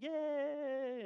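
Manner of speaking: put-on high, thrilled little-kid yay; mock kid cheer. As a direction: squeal like a kindergartner told it's craft time. Kid excited about crafts.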